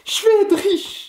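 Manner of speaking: high, excited exclamation; elated. Real, not acted. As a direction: burst out with joy at coming riches. Repeats it, thrilled by the prediction.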